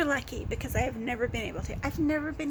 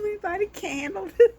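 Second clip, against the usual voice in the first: louder, higher laughing words; overwhelmed by giggles; real laughing fit; 5 semitones higher, 9 dB louder than usual. Real, not acted.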